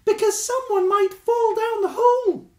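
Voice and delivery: high, loud squeaky badger explaining; explaining Stuart. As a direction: chirp out the explanation. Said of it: "explained Stuart the Badger".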